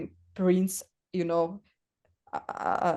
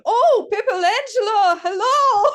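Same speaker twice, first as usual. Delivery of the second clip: loud, high-pitched crowd greeting; excited screaming; 9 dB louder than usual. Acted. Artist imitates festival crowd greeting her, screaming. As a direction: shriek a drawn-out, delighted hello. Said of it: "half of them were screaming".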